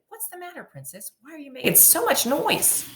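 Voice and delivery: high squeaky frog calling up from the well; a lightly voiced little frog. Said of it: "someone said".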